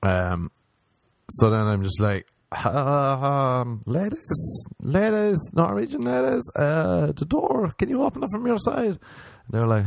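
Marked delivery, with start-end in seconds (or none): 2.7-9.0 high-pitched, sing-out call to the ladies; acted plea in a much higher voice; sheepish calling out. Man locked in bathroom calling to Norwegian ladies, sheepish high call. Calling to women through door.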